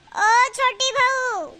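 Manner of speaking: very slow summons, falling at the end; long drawn-out call. Acted. Mother-in-law to younger daughter-in-law, long drawn-out call. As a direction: stretch every word to carry.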